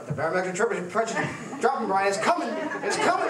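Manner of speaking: mock-urgent, rising to a high laughing squeal; excited Barry. Barry urgently warning his partner the pressure is dropping, mock-excited and high. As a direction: ham up a jittery partner's warning, building up.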